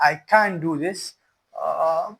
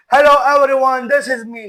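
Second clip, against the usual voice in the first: loud, higher-pitched hello; big projected greeting; 7 semitones higher, 7 dB louder than usual. Shown as a demonstration. Speaker showing a bad example of vocal range, loud and high. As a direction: belt out the greeting, voice raised high. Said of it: "a good range of voice, for example, say".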